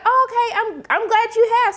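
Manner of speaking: high, sarcastic sweet reply; mock sarcastic. [name] mocking Emmett's payments, high sarcasm.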